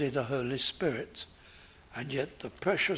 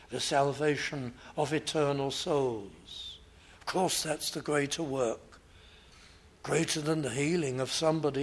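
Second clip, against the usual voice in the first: ordinary talk, nothing marked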